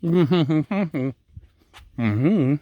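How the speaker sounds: humming with mouth full; chewing while answering. Real, not acted. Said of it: "not to talk with my mouth full".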